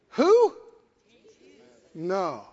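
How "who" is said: high, loud astonished who. Acted. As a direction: gasp out who in disbelief. Acting astonished.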